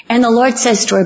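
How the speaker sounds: ordinary delivery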